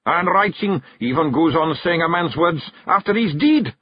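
high Scots warrior, spooked; warding off evil; fearful Feegle. Acted. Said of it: "said Rob Anybody".

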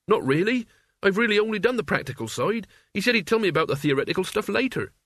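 put-on high-pitched country boy voice; Mort, defensive lad. Mort admitting he only did practical work, high-voiced lad. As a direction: explain yourself in a high boyish voice.

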